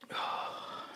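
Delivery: long unvoiced breath out; soft sigh. Real, not acted. Preacher sighs quietly during prayer, a soft breath out. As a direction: let the breath go softly.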